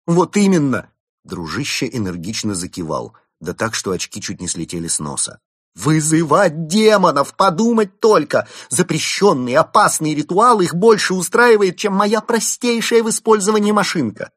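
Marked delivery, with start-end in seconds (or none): 0.1-0.9 eager shout. Acted. Eager agreement. 5.7-14.3 outraged rant. Acted. Inventor rages about the ritual.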